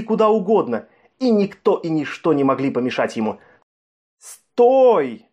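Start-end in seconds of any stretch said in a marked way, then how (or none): 4.2-5.3 sharp, loud, high cry of stop; warning shout. A narrator's reading. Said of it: "повторил своё предостережение".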